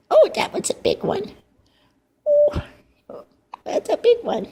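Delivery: high fond surprise; surprised coo. Real, not acted. Baby hiccups loudly.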